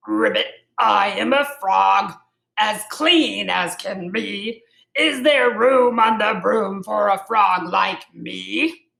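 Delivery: croaky frog. A narrator's reading. Frog asking the witch for a ride, croaking. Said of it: "then said with a croak".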